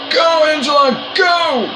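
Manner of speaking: high shouting cheer; excited shout. Real, not acted. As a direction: yell her home. Cheering teammate.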